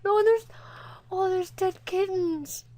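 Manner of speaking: loud, high, drawn-out lament; real heartbroken dismay; distressed pity. Real, not acted. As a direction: wail slowly in real sorrow. Grieving over dead cat and kittens.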